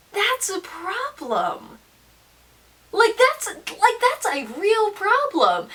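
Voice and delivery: rising disbelief; indignant emphasis. Real, not acted. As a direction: pitch jumps up on that's, problem stretched out slowly. Outraged emphasis.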